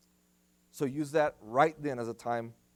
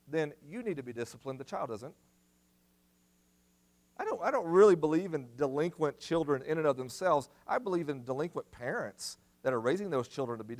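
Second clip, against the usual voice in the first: ordinary talk, nothing marked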